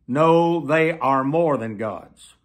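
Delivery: normal talking voice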